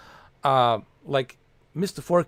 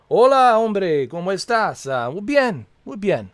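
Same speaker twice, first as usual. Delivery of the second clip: Spanish greeting; 7 semitones higher, 6 dB louder than usual. Retold or imitated.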